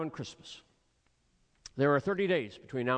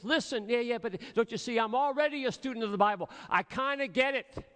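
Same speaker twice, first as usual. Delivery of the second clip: higher, fast smug dismissal; smug brush-off; 6 semitones higher than usual. Retold or imitated. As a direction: wave him off, you know it all. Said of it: "Jesus says".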